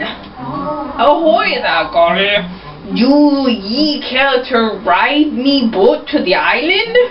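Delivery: put-on lightly done British pirate accent, slow and drawn out; British-sounding pirate voice. Pirate captain greets Golly at the dock, in a faint British pirate accent. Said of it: "my pirate kind of sounds like he's British".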